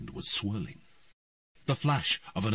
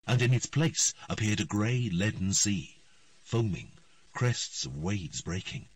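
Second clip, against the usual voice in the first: ordinary delivery, nothing marked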